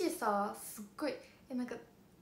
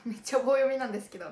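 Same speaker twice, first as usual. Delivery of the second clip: ordinary delivery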